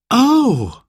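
put-on high, childlike oh of surprise; surprised. A narrator's reading. Boy learns why party is held, surprised. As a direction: let out a high, surprised little exclamation. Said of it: "said Simon in surprise".

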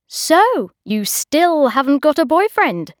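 loud, high booming question. A narrator's reading. Host loudly quizzing Bridget about boyfriends, booming. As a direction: boom it so the room hears. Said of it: "in a loud voice".

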